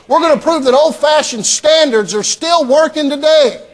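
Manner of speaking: high-pitched rousing preacher's rally, building in force; impassioned preaching. Real, not acted. Preacher urging the church to prove God true, building fervently. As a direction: preach it up to a ringing peak.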